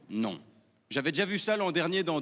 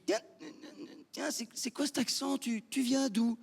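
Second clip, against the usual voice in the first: Parisian local; 6 semitones higher, 4 dB quieter than usual. Retold or imitated. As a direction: imitate a puzzled Parisian in a high put-on voice. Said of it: "je rencontre un autochtone". (Parisien caricaturé, voix haut perchée)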